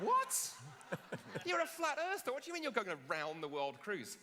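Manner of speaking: high, loud, disbelieving "What?" then falling back; incredulous. Retold or imitated. Friends react in disbelief to the flat earther's cruise, high and loud. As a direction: shriek it in mock disbelief at a friend. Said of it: "And we're like".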